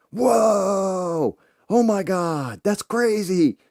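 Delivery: high loud gushing whoa; excitable daughter. Retold or imitated. Imitating the excitable daughter, high and gushing. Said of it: "She's the excitable".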